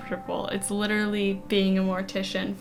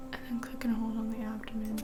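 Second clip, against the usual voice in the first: whispering; 8 dB quieter than usual. Real, not acted. Reading game text in a whisper.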